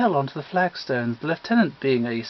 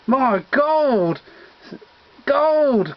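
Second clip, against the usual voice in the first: louder and higher, a greedy cry of gold; raised gleeful cry over treasure; excited cry; 7 semitones higher, 5 dB louder, much slower than usual. Acted. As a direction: shout it like you struck gold. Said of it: "cried Reg".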